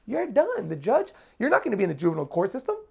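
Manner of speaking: blunt public defender. Retold or imitated. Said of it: "He's like".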